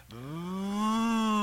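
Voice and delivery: rising engine vroom; small car whine climbing steadily in pitch; imitated car revving. Preacher mimics a show-off's car, rising vroom.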